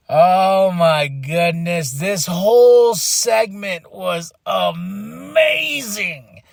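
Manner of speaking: slow, drawn-out amazement, swelling into a shout; amazed raving. Real, not acted. Fan raving about a wrestling segment, amazed. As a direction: stretch the key words in awe.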